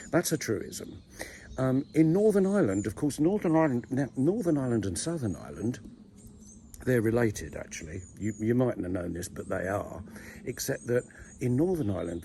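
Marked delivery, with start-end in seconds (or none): none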